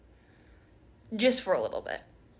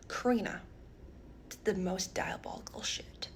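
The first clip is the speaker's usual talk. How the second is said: hushed whisper; dropping to a secret whisper; 4 semitones lower, 12 dB quieter than usual. Real, not acted. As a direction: whisper it like a scandal. Whispered gossip reveal.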